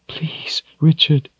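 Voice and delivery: whispered, high, tearful appeal; tearful plea. A narrator's reading. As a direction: breathe the plea through tears. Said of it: "tears in her own eyes".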